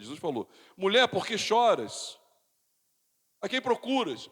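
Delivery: loud, high sermon voice; ringing pulpit delivery; full-force preaching. Acted. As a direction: proclaim the scripture loudly, stretching the quoted question.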